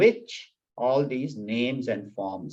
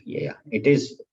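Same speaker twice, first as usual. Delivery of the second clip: usual speaking voice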